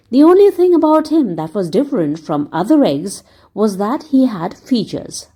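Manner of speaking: plain reading voice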